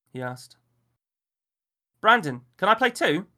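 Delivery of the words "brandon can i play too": put-on high, loud pleading child voice; a little boy begging to play; small boy. A narrator's reading. Child pleads with Miller to play, high and loud.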